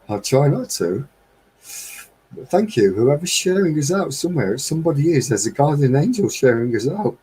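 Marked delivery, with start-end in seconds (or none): 2.3-7.2 higher, voice on the edge of breaking; real emotion choking the words; fighting back tears. Real, not acted. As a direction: thank them while holding back tears. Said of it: "I'm not gonna get emotional".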